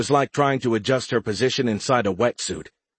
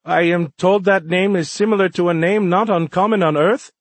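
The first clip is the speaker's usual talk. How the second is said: much higher, louder formal voice; young alien liaison, earnest; 9 semitones higher, 6 dB louder than usual. A narrator's reading. Alien explaining a name to humans, earnest high voice.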